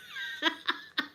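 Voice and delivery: squealing high laugh; shrieking giggle. Real, not acted. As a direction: burst into a shrill laugh. Laughing at own story.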